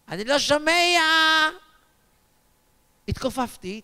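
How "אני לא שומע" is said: mimicked deaf elder calling out, last word stretched; lightly acted old man's complaint; hard-of-hearing old man. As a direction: call it out like someone who can't hear. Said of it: "בקושי זז, עושה לי".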